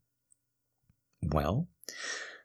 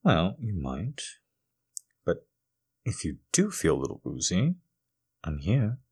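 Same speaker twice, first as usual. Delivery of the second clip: soft reassuring; 9 dB quieter than usual. Acted.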